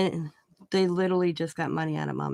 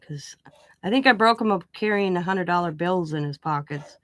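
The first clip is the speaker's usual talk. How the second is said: ordinary talk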